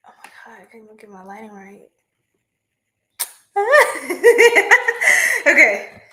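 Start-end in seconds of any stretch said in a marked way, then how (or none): 0.1-1.9 much softer, fast muttered aside. Real, not acted. Reader mutters about her lighting, much softer. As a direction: mumble it to yourself, off mic.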